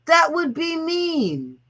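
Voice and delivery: high, slow, prissy imitation of a follower; mock timid reply. Mocking followers who won't block trolls, a high slow whiny voice. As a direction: mimic their timid excuse, slowly. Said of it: "And their response is".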